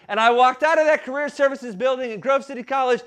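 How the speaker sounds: ordinary talk, nothing marked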